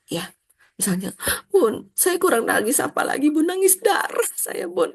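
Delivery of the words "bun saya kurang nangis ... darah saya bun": higher, slow, mock-tearful complaint; imitating a complaining mother. Mother complaining to the teacher about her endless crying, mock-tearful. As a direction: ham up a mother lamenting her tears.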